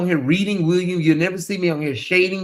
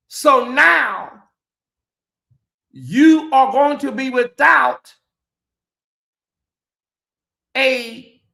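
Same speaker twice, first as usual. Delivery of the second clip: slow, high, emphatic delivery with long pauses; solemn pronouncement; 6 semitones higher, slower than usual. Real, not acted. Host warns his brother William of lost brotherhood, solemn pronouncement. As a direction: pronounce it word by word like a verdict.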